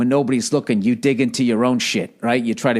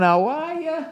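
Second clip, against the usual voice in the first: mock greeting; slower than usual. Podcast greeting, high put-on.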